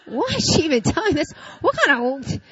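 laughing, incredulous viewer voice; mock the audience's confusion; baffled viewers. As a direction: viewers wondering why she's telling this. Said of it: "You guys are like".

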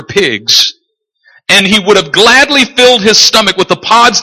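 high, insistent preacher voice; emphatic preaching. Real, not acted. Preaches at full force.

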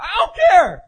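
loud, high-pitched acted defiance; defiant retort. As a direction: throw it back loudly, imitating a hothead. Defiant reply in retold quarrel.

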